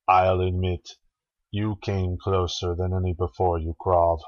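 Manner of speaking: plain reading voice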